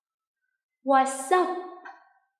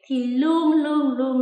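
"luôn" repeated louder each time; emphatic always; 4 semitones higher, 8 dB louder, slower than usual. Real, not acted. Teacher insisting students always explain, loud repeated luôn. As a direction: hammer "luôn" three times. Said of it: "teacher stressing always".

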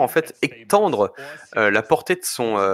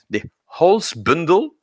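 slow, higher-pitched stab at a German accent; imperfect German accent; 7 semitones higher, slower than usual. Real, not acted. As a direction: attempt the foreign name in a clumsy German accent. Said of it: "mon accent allemand n'est pas si parfait".

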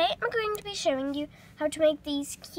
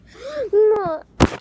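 loud gasp; 5 semitones higher, 11 dB louder than usual. Pretend. Sudden dramatic reaction.

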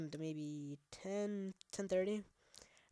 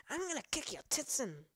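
the threat again, pitched higher and hammed up; mock rage at the monster, higher; 7 semitones higher than usual. Gamer mock-threatens an enemy, pitched higher and hammed up. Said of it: "I'm over-exaggerating".

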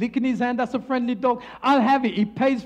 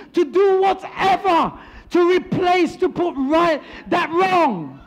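higher, emphatic sermon delivery; preaching; 5 semitones higher, 3 dB louder than usual. Real, not acted. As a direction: drive the lesson home to a congregation. Preaches the lesson from story.

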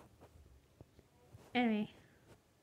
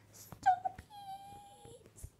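high-pitched cry. Acted.

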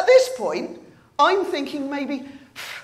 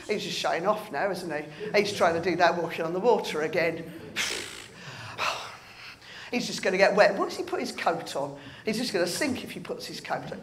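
quick, softer muttered aside; snide grumbling; 6 semitones lower, 7 dB quieter, faster than usual. Acted. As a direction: grumble behind his back, rolling your eyes. Disciples grumbling about Peter.

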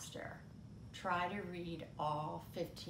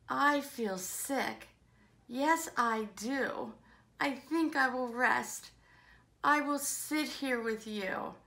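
queasy, subdued; 4 semitones higher, 8 dB louder than usual. Acted. Minnie admits feeling sick.

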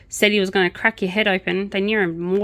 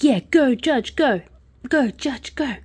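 higher, cheering the judge on; 5 semitones higher than usual. Retold or imitated. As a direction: chant it like a fan in the stands. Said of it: "And I'm like".

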